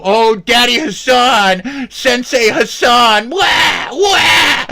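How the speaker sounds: high, loud whiny wailing; mocking crybaby. Streamer mocking Sean calling for help, crybaby wail. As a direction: wail like a baby running to daddy. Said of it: "He little".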